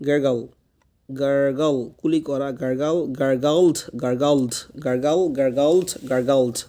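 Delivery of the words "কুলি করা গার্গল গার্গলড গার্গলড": ordinary talk, nothing marked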